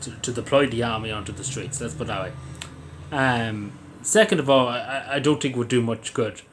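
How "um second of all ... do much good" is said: ordinary talk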